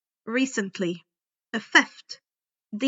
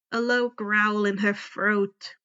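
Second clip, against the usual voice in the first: usual speaking voice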